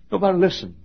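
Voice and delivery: normal talking voice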